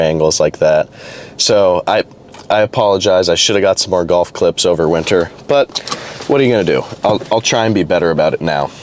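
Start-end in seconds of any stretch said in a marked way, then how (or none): none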